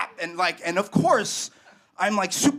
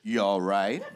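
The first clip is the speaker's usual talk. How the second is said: skeptical cop; 10 semitones lower than usual. Retold or imitated. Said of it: "and he goes".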